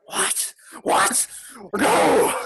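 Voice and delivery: rising panicked what, what, no; panicked cry. Acted. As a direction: voice the villain losing his nerve. DM voices panicking Sirak.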